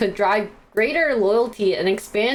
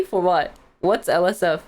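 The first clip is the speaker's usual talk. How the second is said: ordinary talk, nothing marked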